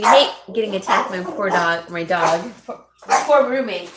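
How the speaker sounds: loud calls over the dog chaos; half-laughing loud call across the room. Real, not acted. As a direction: raise the voice to stop the dog. Calling out over dog attacking roommate.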